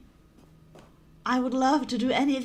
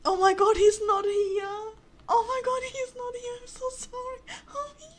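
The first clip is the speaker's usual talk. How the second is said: wail, then shrink to a whimper; tearful distress; 7 semitones higher, 4 dB louder than usual. Real, not acted. Player finding the narrator gone, tearful distress.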